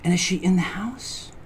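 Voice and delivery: a hushed whisper, breathy and secret; whispered question. Real, not acted. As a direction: whisper it so no one in the house hears. Wondering if the sender is present.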